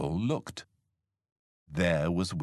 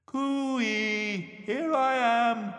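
slow, high cooee call; playful calling; much slower than usual. A narrator's reading. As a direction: draw it out high to be found. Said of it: "It called".

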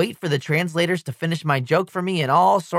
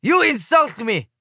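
put-on slow, high, stressing YOU, accented; Slovenian cry; 5 semitones higher than usual. A narrator's reading. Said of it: "cries the Slovenian guy".

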